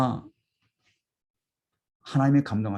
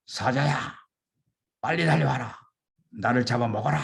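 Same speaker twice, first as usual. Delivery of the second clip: a martyr calling out boldly, pitched up; defiant cry; 3 semitones higher than usual. Retold or imitated. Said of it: "큰 소리로 외쳐댔어요".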